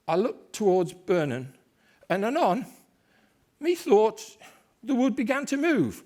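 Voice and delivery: frightened messenger. Acted. Servant stammering out the news to Macbeth, rising alarm. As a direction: pitch climbs as the news gets stranger. Messenger reports moving wood.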